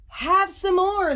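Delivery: high, slow sing-out offer; generous storybook witch; hostess offering. A narrator's reading. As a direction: offer seconds in a lilting high voice. Witch offers pie to guests.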